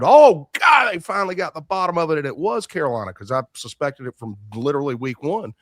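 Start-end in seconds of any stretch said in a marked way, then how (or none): 0.0-2.2 sudden high, loud "Oh, God", then a fast rush; retold exclamation. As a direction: relive the moment the answer finally came. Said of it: "I said".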